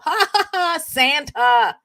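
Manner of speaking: loud high ha ha ha; laughing. Real, not acted. Host laughing at the Satan text, loud laughter. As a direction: belt out a laugh.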